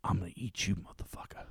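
whispering menacingly to the fish; hushed threat, breathy and unvoiced; creepy whisper. Friend whispering threats at the goldfish, creepy whisper. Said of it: "he's like".

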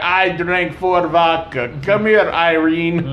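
put-on slow, lightly Russian-accented; mock drunk Russian. Joking about Russian Popeye, slow mock Russian. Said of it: "You're like".

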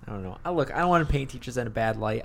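normal talking voice

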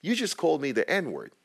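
high-pitched, indignant retelling; angry reproach. Retold or imitated. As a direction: confront someone crying, fed up. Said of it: "the more angry I got".

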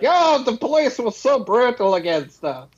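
mock whiny complaint.

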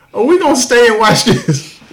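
high, loud, laughing question; laughing disbelief. Retold or imitated. Crowd wondering whether to stay, laughing disbelief. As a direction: ask it while cracking up.

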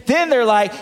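usual speaking voice

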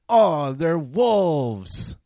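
high, very slow dreamy mock awe; drawn-out sarcastic sigh of admiration; mock swoon. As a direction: swoon over them sarcastically. Mocking romanticized native wolves.

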